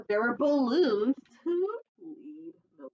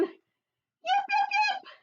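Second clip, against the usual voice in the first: very high little fox yips; high squeaky fox call. Acted. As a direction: yap it out like a small fox calling. Fox calls again in story.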